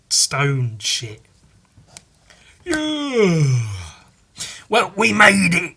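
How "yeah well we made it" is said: put-on old man voice. Voicing old man arriving.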